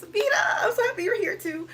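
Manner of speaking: high, loud, gushing welcome; excited greeting. Real, not acted. Host greeting a viewer she's happy to see, high excited welcome. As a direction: squeal a delighted hello.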